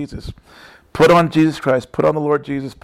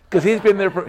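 usual speaking voice

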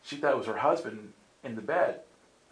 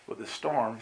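ordinary delivery

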